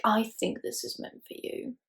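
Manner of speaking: slow, soft, whispery imitation; mock earnest whisper. Vlogger mimicking her giving Tessa the work, mock earnest whisper. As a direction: whisper it slowly as a mock confidence. Mocks character handing over the work.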